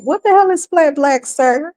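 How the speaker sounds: high-pitched disbelieving question; incredulous. Real, not acted. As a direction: scoff at the phrase in disbelief. Scoffing at a phrase he heard.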